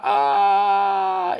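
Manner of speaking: high, loud, long mock scream; flying scream. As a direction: scream as if flung through the air. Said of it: "Y Floyd grita". (grito agudo y largo)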